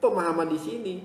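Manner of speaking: ordinary talk, nothing marked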